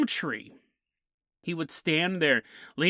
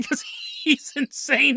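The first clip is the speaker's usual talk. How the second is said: ordinary delivery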